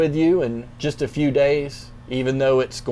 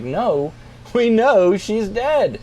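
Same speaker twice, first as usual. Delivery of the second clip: higher, louder, slow mocking disbelief; scoffing; 7 semitones higher, 3 dB louder, slower than usual. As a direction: drag it out like they think he's a fool. Mourners scoff at Jesus.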